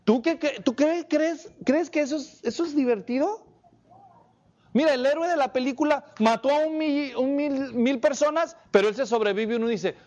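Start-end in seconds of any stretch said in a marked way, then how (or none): 0.0-6.7 parent questioning. Retold or imitated. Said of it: "y le digo".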